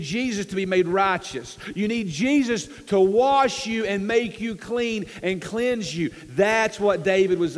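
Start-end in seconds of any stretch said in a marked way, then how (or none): none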